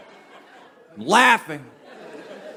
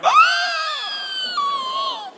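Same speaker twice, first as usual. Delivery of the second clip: sudden high gasped "Ah" on a sharp inhale. Retold or imitated. Uncle gasping as the skunk warning sinks in, a piercing high inhale. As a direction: suck the air in with a piercing high yelp. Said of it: "He just sort of went".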